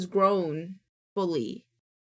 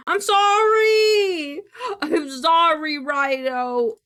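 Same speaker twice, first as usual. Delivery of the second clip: high, loud, slow fake wail; mock wailing apology; 7 semitones higher, 9 dB louder, much slower than usual. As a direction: ham up a crying apology.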